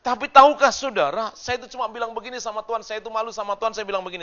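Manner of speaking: loud, high, ringing sermon voice; full-force preaching. Acted. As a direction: preach it out to the whole church, slowing on the key lines. Khotbah dengan suara penuh. (khotbah berapi-api dengan suara lantang)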